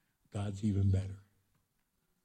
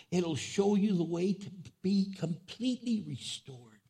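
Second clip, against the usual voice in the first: rising, fervent preacher voice, pitched up; preaching prayer; 7 semitones higher than usual. Acted. Pastor voicing a prayer for grieving church members, fervent preaching. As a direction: lift the prayer higher and higher with passion.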